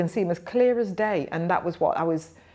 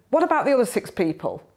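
high, loud challenge from a matron; retold ward sister pulling up a junior, forceful; robust matron; 7 semitones higher, 5 dB louder, faster than usual. Sister questioning the student nurse, robust matron. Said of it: "very robust and".

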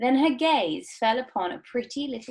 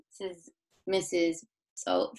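ordinary delivery, nothing marked

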